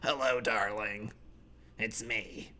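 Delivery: soft, low "Hello, darling" barely there; whisper-croak it out of a ruined throat; croaky sick voice. Pretend. Sick gamer greeting someone online, soft croak. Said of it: "I have throat cancer".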